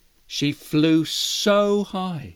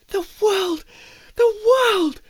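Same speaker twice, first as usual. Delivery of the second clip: awed mouse voice; 12 semitones higher than usual. Acted. Mouse answers eagle.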